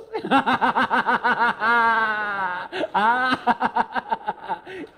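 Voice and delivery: long, loud, high belly laugh. Real, not acted. Speaker cracking up at his own innuendo, loud laughing. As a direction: burst into rolling, uncontrolled laughter. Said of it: "kenapa saya ketawa terus".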